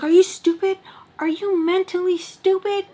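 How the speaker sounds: high-pitched, incredulous mock outrage; pissed-off grandma. Grandma berating her granddaughter on the phone, mock pissed-off. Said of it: "she was so pissed off".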